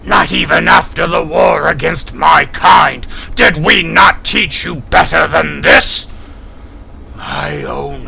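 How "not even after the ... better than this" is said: loud, higher scolding shout; angry shout. Acted. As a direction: hammer the reproach home. Kilrathi scolds the whining human.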